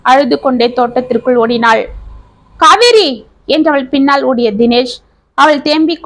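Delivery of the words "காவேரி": higher-pitched call after someone; urgent raised call. Acted. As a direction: call out the name to stop them. Calling after her as she runs.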